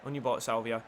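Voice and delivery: ordinary delivery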